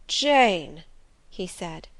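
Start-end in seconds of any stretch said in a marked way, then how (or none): 0.1-0.8 a louder, aching cry of her name; anguished lover's cry; bitter sadness. A narrator's reading. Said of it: "accent of bitter sadness".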